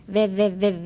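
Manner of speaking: "ve" repeated as separate short beats; split syllables. Shown as a demonstration. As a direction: drill "ve" on its own, piece by piece. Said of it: "veとreで分けて".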